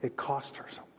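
breathy hushed repeat of the line; whisper. Acted. Preacher whispering to the congregation for effect. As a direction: drop to a whisper to let it sink in.

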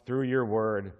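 hushed reverent amen; prayer. Real, not acted. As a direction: pray it quietly to a close. Closing prayer before sermon.

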